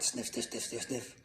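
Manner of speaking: quiet, quick rhythmic sniffs; sniffing noises. A narrator's reading. Moose smelling a carrot, quiet rapid sniffing. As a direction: whiff the words softly and rapidly.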